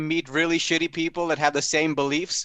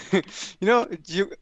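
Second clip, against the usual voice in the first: usual speaking voice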